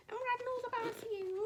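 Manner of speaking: very high, loud ranting imitation; exaggerated high-pitched scolding; mocking head of year. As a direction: mimic a teacher's tirade up high. Mimicking head of year's rant.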